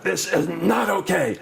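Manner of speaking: high, slow, stern telling-off; slow, high outraged teacher; angry teacher outburst. Retold or imitated. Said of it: "my voice exceeded the decibel level".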